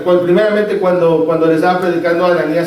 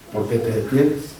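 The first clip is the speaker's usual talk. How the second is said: soft slow question; 9 semitones lower, 7 dB quieter than usual. Acted. Repeating question softly.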